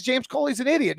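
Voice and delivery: usual speaking voice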